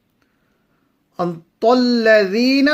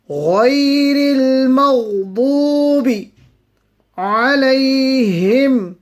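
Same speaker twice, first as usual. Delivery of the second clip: melodic tajweed recitation, a touch higher; Quran recitation; 6 semitones higher, 5 dB louder, slower than usual. Acted. Teacher reciting the verses for students, melodic and measured. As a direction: chant the verses slowly and evenly.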